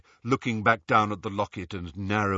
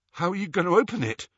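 frightened; 7 semitones higher, much faster than usual. A narrator's reading. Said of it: "He looked terrified".

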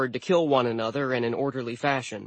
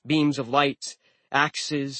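ordinary delivery

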